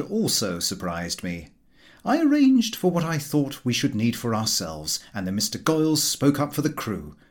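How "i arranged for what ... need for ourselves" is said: plain reading voice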